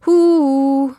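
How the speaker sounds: loud high "Hu" called out; calling out. A narrator's reading. Michi calls loudly to startle Charles. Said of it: "rief der Michi".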